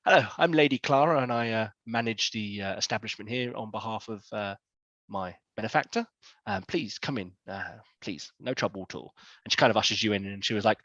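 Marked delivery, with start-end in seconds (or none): 0.1-9.1 soft, low, genteel hostess; gracious older lady, voice dropping quieter; Lady Clara. Acted. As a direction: welcome them softly, with polite hesitations. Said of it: "she's getting on in years, but she's very voluptuous".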